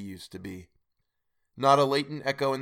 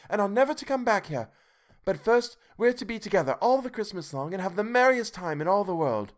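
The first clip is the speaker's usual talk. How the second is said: put-on much higher, bright child voice; eager little sister fetching her brother; little girl; 8 semitones higher than usual. A narrator's reading. Fan continues.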